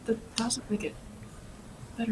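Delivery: whispered aside to viewers. Real, not acted. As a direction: drop to a breathy whisper.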